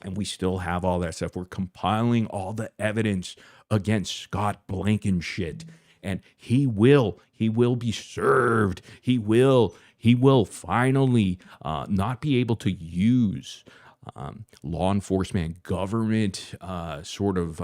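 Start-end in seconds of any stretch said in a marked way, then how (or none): 6.0-11.4 slow, loud, stressing each will; emphatic vow. Real, not acted. Vowing to viewers the man will pay, loud and slow. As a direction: hammer every will home.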